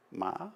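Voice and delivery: "ma" bent up like a question mark. Shown as a demonstration. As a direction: say "ma" dipping, then rising. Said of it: "with the six different marks on it".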